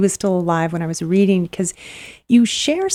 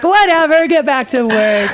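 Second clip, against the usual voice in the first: mock heckle, high and louder, then slowing; retold jeering yell; 7 semitones higher, 3 dB louder than usual. Interviewer voicing an office crowd brushing off a suitor, mock yell. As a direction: imitate coworkers loudly dismissing him. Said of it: "everyone, like, yells at him".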